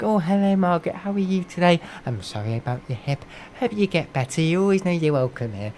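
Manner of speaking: high, sugary mock-polite host voice; fake nice greeting. Streamer mocking fake-nice channels, sugary polite. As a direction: lay on the fake friendliness. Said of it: "I hate that".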